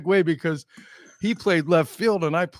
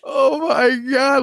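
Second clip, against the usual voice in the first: stunned; 8 semitones higher, 5 dB louder, slower than usual. Retold or imitated. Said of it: "And I go".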